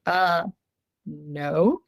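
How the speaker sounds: a hint of a low, flat creature reply; creature's reply. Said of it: "It's like".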